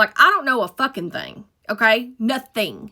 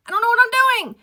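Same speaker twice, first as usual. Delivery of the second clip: much higher, squeaked in despair; desperate squeal; 13 semitones higher, 3 dB louder, faster than usual. Retold or imitated. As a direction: squeak it out, at the end of your rope. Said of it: "And I'm like".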